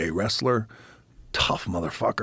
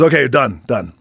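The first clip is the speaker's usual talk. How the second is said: louder, higher curt call; 5 semitones higher, 10 dB louder than usual. Retold or imitated. The mentor calls an end to the training match, loud and curt. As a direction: cut the match off short.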